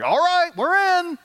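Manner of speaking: high, drawn-out eager people; eager crowd. Retold or imitated. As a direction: sing it out like a crowd signing up. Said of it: "They're going".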